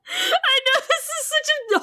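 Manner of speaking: high, laughing through words; can't stop laughing at the sad bit. Real, not acted. Laughing at heartbreaking scene.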